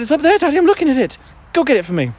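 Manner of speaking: high put-on voice for the dog; dog's voice. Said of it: "He said".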